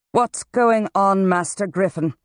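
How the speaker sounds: high, insistent question; demanding. A narrator's reading. Dean marching into the classroom to Griffin, demanding. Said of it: "she demanded".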